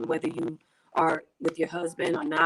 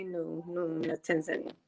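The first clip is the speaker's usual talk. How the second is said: quiet denial; 3 semitones lower, 6 dB quieter than usual. Retold or imitated. Retold answer to locals asking.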